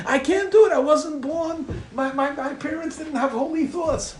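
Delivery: high, pleading mock protest; protesting excuse. Reader makes excuses to the scolding book, high mock protest.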